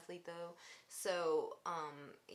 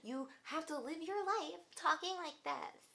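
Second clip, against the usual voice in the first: lightly put-on girly voice, higher; mock valley girl voice; 8 semitones higher than usual. Mocking the girl's forced voice, light valley girl impression. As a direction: tease her forced way of talking. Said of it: "force that voice out".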